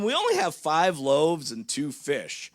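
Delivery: slow, drawn-out mock whine; whining complaint. Disciples whining to Jesus about the food, slowly. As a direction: complain like a tired kid. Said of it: "They whine and complain".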